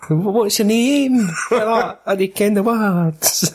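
high, slow put-on American; badly faked American, pitched high; mock American accent. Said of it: "so it's like that, but it's proper American".

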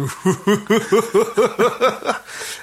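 high, hooting mock laugh; rising run of hoots, breaking into laughter; imitated Predator laugh. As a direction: imitate a creature's staccato laugh. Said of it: "it just starts fucking".

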